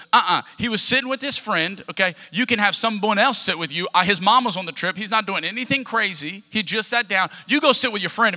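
acted-out mama laying down the law; protective mama. Mom defending son to teacher, firm protective scolding. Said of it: "she goes".

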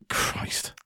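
breathy whisper aside; whispered curse. Real, not acted. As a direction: whisper it to yourself.

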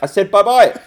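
high, loud bye-bye through a laugh; mock insistent send-off. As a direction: push him out again, half laughing. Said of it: "and he's like".

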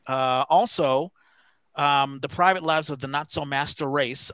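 ordinary talk, nothing marked

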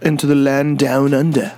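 attempted Australian slipping into English; lightly put-on English accent. Shown as a demonstration. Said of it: "That was English".

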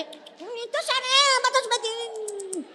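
put-on high, whiny babyish voice; mocking a crybaby believer. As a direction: whimper like a pouting baby.

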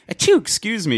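high, prim little sneeze and apology; dainty sneeze. Retold or imitated. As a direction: play a polite, delicate sneezer. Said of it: "it's not like you're just like".

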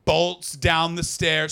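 ordinary talk, nothing marked

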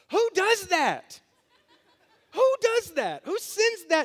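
high, loud, laughing disbelief; laughing exasperation. Retold or imitated. Pastor scolds his friend on the phone, laughing and exasperated. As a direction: cry out in amused outrage.

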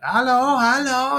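high, loud calling out; echoing shout of hello; yelling hello. Retold or imitated. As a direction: call out across an empty building. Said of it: "This guy just starts yelling".

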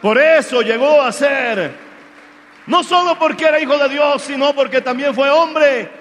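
loud, fervent preacher build with hallelujahs; rising revival sermon, loud and urgent; full-force preaching. Acted. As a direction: preach it out over the music. Preaching with music.

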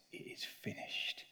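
slow, breathless whispered last words; hushed slow whisper of the cross. Acted. Cried out in a loud voice.

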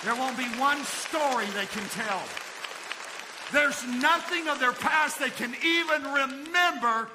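loud, high, impassioned preacher; full-force preaching. Acted. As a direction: thunder it out to a big congregation.

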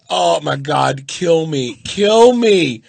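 rising from despair to fake cheer; mortified inner voice. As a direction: groan kill me then force a bright hello. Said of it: "and I'm like".

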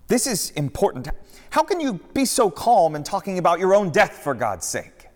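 high, loud, pleading disciple; frantic Peter. Acted. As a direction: Peter begging his master not to give up. Peter protests loudly.

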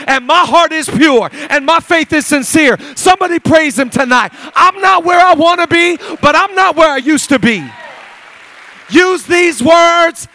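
fired-up preacher shouting down the devil; full-force preaching. Acted. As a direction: preach it hard and trash-talk the devil.

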